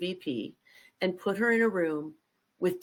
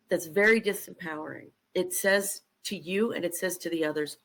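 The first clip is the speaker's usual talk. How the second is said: ordinary talk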